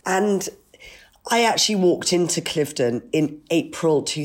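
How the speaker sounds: hoarse croaky voice from a cold. Real, not acted. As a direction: speak through a sore croaky throat. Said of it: "Apologies for my croaky voice".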